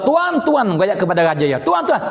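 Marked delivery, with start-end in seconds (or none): 0.0-0.7 high-pitched call to a master; acted soldier's call. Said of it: "Dia kata". 1.6-2.1 much higher mock call to the lord; acted soldier's call. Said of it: "Dia kata".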